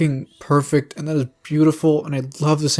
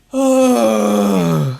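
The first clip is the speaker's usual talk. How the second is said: loud exasperated sigh; 7 semitones higher, 5 dB louder than usual. Real, not acted.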